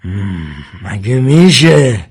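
slow scoffing question with a drawn-out hum; lazy sneer. A narrator's reading. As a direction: scoff slowly, as if it were impossible. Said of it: "و پوزخند زد".

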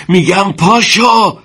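loud, slow, high cry of get up; desperate shout. A narrator's reading. Soldier shouting at his wounded friend, loud and slow. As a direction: yell at him to stand, drawn out. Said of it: "داد زد".

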